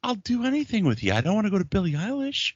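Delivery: high mock complaint; mock pleading. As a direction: whine it to the wife. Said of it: "I'm like".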